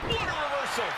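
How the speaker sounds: surprised. Acted. Sudden reversal in match.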